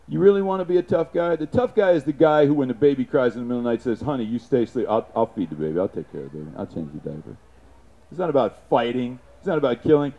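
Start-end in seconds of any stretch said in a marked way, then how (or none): 4.0-7.4 tender husband. Retold or imitated. Tough guy tells wife.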